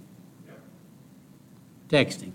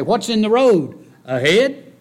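high, loud, fervent sermon delivery; preaching at full force; 11 semitones higher, 5 dB louder than usual. Acted.

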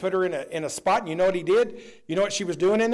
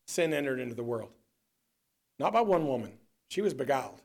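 much lower and softer; hushed preaching; sudden quiet; 7 semitones lower, 5 dB quieter, faster than usual. Real, not acted. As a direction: drop the voice to let it land. Preacher drops voice after shout.

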